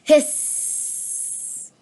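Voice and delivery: long voiceless hiss; cat hiss. Shown as a demonstration. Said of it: "That's onomatopoeia".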